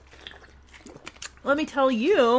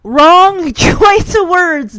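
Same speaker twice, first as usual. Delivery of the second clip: laughing loudly; 6 semitones higher, 12 dB louder, much slower than usual. Real, not acted. Laughing at the show's line.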